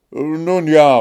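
impatient call. A narrator's reading. Guards rushing Märchen, loud impatient call. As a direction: hurry her up. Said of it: "riefen sie".

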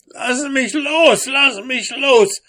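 very high, loud, squealing dwarf voice; loud high howl of a trapped dwarf. A narrator's reading. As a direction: wail in a high-pitched dwarf voice, drawing out the plea. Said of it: "heulte der Zwerg".

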